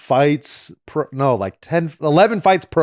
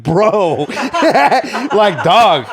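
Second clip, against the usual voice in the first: laughing fighter; 7 semitones higher, much slower than usual. Retold or imitated. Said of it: "he's looking at me like".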